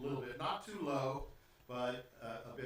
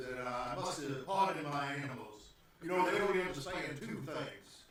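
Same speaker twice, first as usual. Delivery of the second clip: put-on southern-accented gentleman host. Host pardons his animals to the visitors, southern accent. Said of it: "Why does he got a southern accent?".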